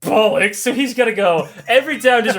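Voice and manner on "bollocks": mock British grumble. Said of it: "he's like".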